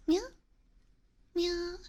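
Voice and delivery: high, squeaky mock cat meow; teasing meow at a chat friend; playful meow.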